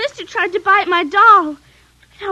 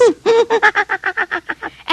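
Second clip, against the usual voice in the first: sinister doll laugh; 5 semitones higher than usual. Acted.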